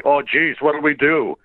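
normal talking voice

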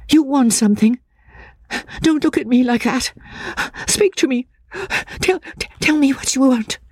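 high, frantic woman's voice; plead, teetering on losing herself; frightened woman. A narrator's reading. Frightened woman begging Walter to change the subject, high and desperate.

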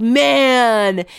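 laid-back Southern California surfer voice; surfer dude. Retold or imitated. Imitating tipsy husband as a Southern California surfer. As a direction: drawl it like a tipsy SoCal surfer. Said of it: "He sounds like a surfer from Southern California".

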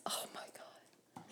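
shocked whisper. Real, not acted. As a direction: breathe it out, stunned. Reacting to the purchase.

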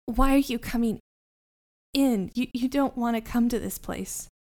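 a hint of a frightened kid, slightly halting; lightly voiced, hesitant plea; frightened Fleance, lightly voiced. Fleance warning the party away from this place, lightly voiced fear.

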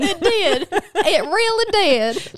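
high, laughing Southern drawl; Southern accent. Pretend. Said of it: "butcher your accents now".

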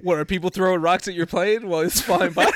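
laughing incredulous tease; laughing disbelief. Real, not acted. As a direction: tease him through laughter.

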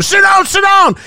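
high-pitched mock yell; imitated yell. Fan imitating a man yelling at someone blocking the view, mock yell. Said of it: "the guy behind him yelling at him".

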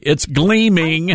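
"gleaming" drawn out slowly, starting on GL; corrective enunciation. Shown as a demonstration. Host corrects caller's misheard title, "gleaming" slowly enunciated with GL. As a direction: spell out "gleaming" by ear, very slow. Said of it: "do a better job of that".

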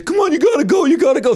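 high, squeaky mock pleading; urging bouncers. Young bouncers urging the crowd out, high mock voice. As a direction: push them out with a strained high voice.

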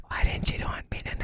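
breathy whisper, voicing her; whispered question. Retelling her line to a cohost in a whisper. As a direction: whisper it like a tender secret. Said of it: "And then she goes".

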